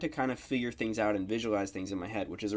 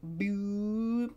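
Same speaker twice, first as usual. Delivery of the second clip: boop sound; 8 semitones higher, 3 dB quieter than usual. Shown as a demonstration. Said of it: "the pitch is gonna go up".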